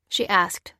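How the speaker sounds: ordinary delivery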